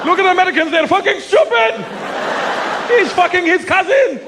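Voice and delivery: high, laughing mock sneer at Americans. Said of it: "all they show them of America".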